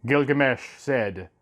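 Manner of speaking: usual speaking voice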